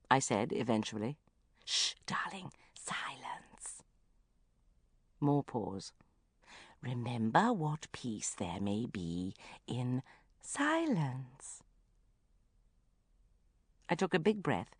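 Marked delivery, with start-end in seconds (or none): 1.6-3.8 hushed whispered shush. Acted. Mother hushing on phone. 6.8-11.6 very slow, soft chant; soft intoning. Acted.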